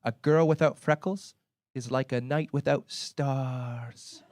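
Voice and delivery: slow, lilting Irish charmer; Irish sweet-talk, trailing softly on the last word; Irish accent. Retold or imitated. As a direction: woo her slowly in an Irish accent. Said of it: "an Irishman staggered up to her".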